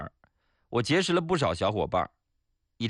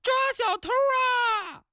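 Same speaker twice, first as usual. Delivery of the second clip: very high, drawn-out cry for help; woman's panicked shout; slower than usual. Acted. Woman chasing a bag snatcher, shouting for help. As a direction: shriek it out slowly in a shrill put-on voice. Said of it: "女的边跑边喊".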